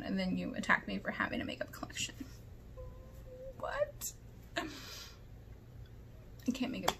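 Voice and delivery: breathless disbelieving whisper; whispered baffled rant; incredulous whisper. Real, not acted. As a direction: hush the words in disbelief.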